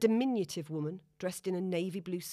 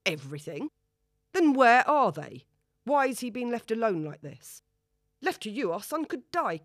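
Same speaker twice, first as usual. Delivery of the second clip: shouted, much louder on "then where are they"; furious outburst; 5 semitones higher, 8 dB louder than usual. A narrator's reading. Father rages at empty ward, shouting. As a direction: blast the question at her.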